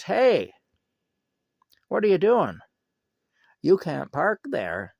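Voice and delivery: high, loud retold yell; loud, high mock yell at a parked driver. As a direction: bark it at him like a self-appointed parking cop. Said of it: "he yells at him".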